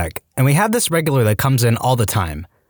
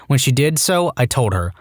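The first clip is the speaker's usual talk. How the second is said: plain reading voice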